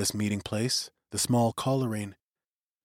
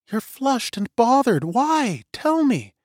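much higher, urgent pleading woman's voice; anxious young woman; 11 semitones higher, slower than usual. A narrator's reading. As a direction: press him to tell, worried. Suri urges Till, much higher.

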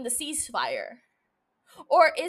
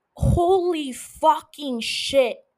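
slow, drawn-out, louder awed exclamation; 5 dB louder, much slower than usual. Real, not acted. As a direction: let the amazement spill out slowly. Raving about loved show.

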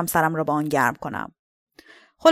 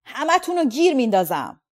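shouting; 5 semitones higher than usual. A narrator's reading. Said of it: "فریاد کشید".

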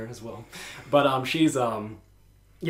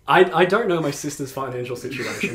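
ordinary talk, nothing marked

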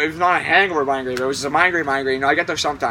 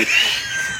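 high laugh burst. Real, not acted. Laughs after reenacted yell.

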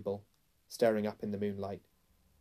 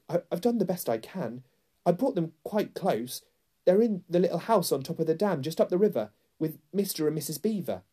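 put-on high, anxious boy voice; eager, worried self-defence; frightened boy; 7 semitones higher, 4 dB louder than usual. A narrator's reading. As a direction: plead nervously, pitched high. Frightened boy answers the angry queen.

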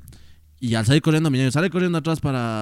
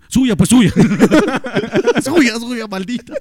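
much higher and louder, laughing mock accusation; hammed-up angry mom shouting blame; mom blaming loudly; 11 semitones higher, 9 dB louder, slower than usual. Teller imitates his mother loudly blaming him, laughing through it. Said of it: "¿Culpa de quién?".

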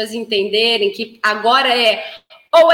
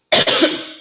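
short cough. Real, not acted. Lingering cough after choking.

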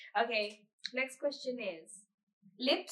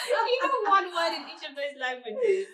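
teasing her friend through laughter, louder and higher; laughing tease; 6 semitones higher, 7 dB louder, slower than usual. Real, not acted. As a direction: laugh while mocking her language claims. Teasing friend about languages.

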